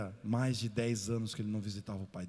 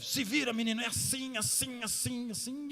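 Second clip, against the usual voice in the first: a stern father's mock scolding; 11 semitones higher than usual. Harsh father teaching his son.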